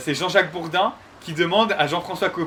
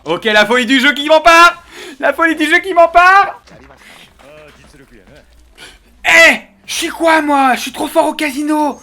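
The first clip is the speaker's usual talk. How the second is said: loud, high hyped gambling cheer; excited; 9 semitones higher, 8 dB louder than usual. Real, not acted. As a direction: shout with gambler's glee. Winning at blackjack.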